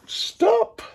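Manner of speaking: squeaky high, drawn out "stop"; mock high stop. Real, not acted. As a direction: jump way up and stretch it, self-mocking. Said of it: "I did not do it justice singing it".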